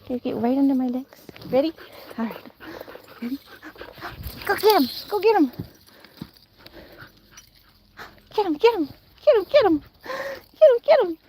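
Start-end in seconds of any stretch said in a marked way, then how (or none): 4.5-11.2 sing-song excitement building to a squeal; excited urging. Real, not acted. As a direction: rev the dogs up more with each go. Urging dogs to chase.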